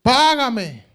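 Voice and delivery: high, loud cold demand; arrogant demand. Acted. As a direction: bark it at the kneeling man. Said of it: "El que estaba ahí postrado, llorando, rogando".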